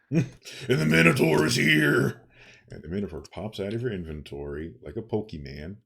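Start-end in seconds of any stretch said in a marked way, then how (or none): 0.1-2.2 loud, higher, triumphant entrance call; excited announcement. Acted. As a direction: announce the monster like a showman. GM summons the Minotaur loudly.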